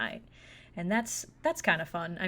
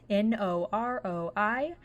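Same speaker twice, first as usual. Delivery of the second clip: normal talking voice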